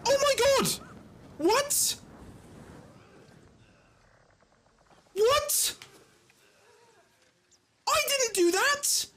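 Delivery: high-pitched squeal of disbelief; stunned, very high yelps; shocked outcry. Real, not acted. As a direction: cry out in shock, pitch shooting way up. Shocked reaction to the game.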